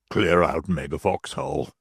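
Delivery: a worried man swearing under his breath; Stracey's voice. Acted.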